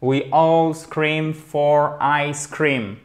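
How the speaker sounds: very slow, drawn-out tongue twister; "We all scream for ice cream" at a deliberate crawl; slow model reading. Shown as a demonstration. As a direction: stretch every word for learners. Closing careful demonstration after accent remark.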